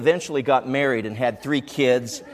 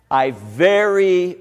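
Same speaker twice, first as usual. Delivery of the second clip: ordinary talk, nothing marked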